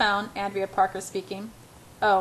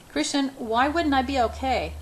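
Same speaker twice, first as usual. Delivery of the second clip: plain reading voice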